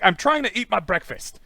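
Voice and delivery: loud, high, fed-up protest; mock exasperation. Woman pestered at breakfast, mock-exasperated high protest. Acted exasperation at sea lion.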